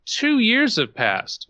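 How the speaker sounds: ordinary talk, nothing marked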